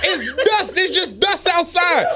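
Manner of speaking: high, giggling through made-up names; mock him while cracking up; mocking the coworker with absurd dust names, laughing; sarcastic laughing.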